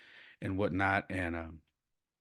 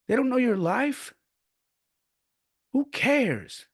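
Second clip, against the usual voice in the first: high-pitched imitated granddad rant; mock-exasperated outburst; impassioned dismissal; 13 semitones higher, slower than usual. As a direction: wave it all away, voice pitched right up. Acting out grandfather's fierce lesson.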